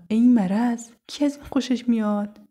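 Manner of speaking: high-pitched, indignant comeback; loud burst, then indignant question; annoyed retort. Acted. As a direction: snap back at a teasing friend. Sara snaps back at teasing.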